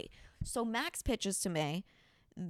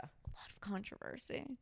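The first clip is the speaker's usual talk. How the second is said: whispered aside, voice dropped to nothing; 11 dB quieter than usual. Acted. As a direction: breathe it out as a secret. Whispered aside about controversy.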